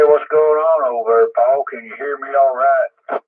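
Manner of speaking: normal talking voice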